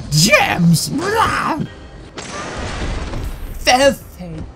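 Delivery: drawn-out put-on voice; exaggerated, slow character growl. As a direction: stretch it out in a silly character voice. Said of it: "that sort of a voice".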